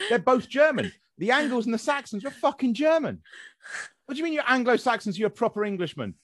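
high-pitched, incredulous outburst; exasperated rant. Acted. Arguing with self-styled Anglo-Saxons, exasperated.

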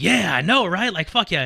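loud cocky bragging. Retold or imitated. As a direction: brag it proudly. Retold cocky drunk boast.

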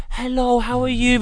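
high, loud mock-friendly hello; put-on greeting. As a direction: imitate an overly polite greeting. Retells relatives greeting him.

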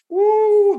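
high, loud joyful woo; joyful whoop. Real, not acted. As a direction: let out a whoop of praise. Preacher overcome with joy.

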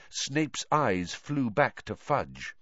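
ordinary delivery, nothing marked

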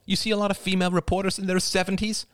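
put-on young woman character voice. Crystal's niece pleading with her aunt, character voice. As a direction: give the character her own bright young voice.

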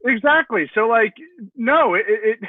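high and loud, mock scolding; incredulous call-out. Real, not acted. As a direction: tell him off in disbelief. Mock scolding Big Ben directly.

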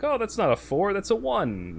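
high-pitched, feigned innocent surprise; mock innocent correction. Retelling arms dealer's sly miscount.

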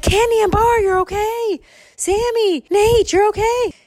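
high, loud parental cries; squealed, overjoyed greeting; relieved calling. Acted. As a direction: call out to found children in acted relief. Parents find the missing kids.

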